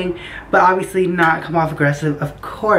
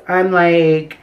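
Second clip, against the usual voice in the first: usual speaking voice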